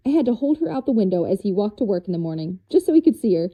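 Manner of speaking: normal talking voice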